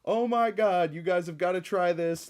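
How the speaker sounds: high, loud, slow gushing fan voice; overjoyed online reviewer, hammed up. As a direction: ecstatic fan raving about pills, playful mockery. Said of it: "one in three will just be like".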